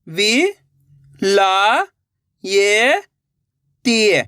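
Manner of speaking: वि, ला, ये, ती drawn out with gaps; syllable by syllable. Shown as a demonstration. Teacher breaking a word for learners, syllable by syllable. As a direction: sound out वि, ला, ये, ती singly. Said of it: "एक अक्षर का उच्चारण करके".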